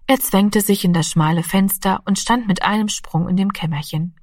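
ordinary delivery, nothing marked

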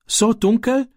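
high, halting, scared little gasp of a question; a frightened boy stumbling over the words; stammering fright. A narrator's reading. Ram, scared of the dark walk home, stammers high. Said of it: "stammelte Ram".

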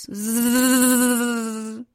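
long zzz hum from a glass; sustained insect drone, swelling then easing; mosquito buzz. Acted. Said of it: "da hören sie's".

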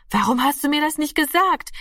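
high, urgent question; upset reproach. Acted. Parent questions daughter about the missing boy, upset and urgent. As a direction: reproach her in rising alarm.

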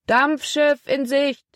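high, slow drawn-out call across the water; man calling from shore. A narrator's reading. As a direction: sing it out to the whole town, slowly. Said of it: "Vom Ufer erklang die Stimme eines Mannes". (gedehnter, hoher Ruf vom Ufer)

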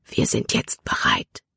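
hushed, voiceless whisper; low-key confidential whisper. A narrator's reading. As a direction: whisper it as a secret. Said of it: "flüsterte er".

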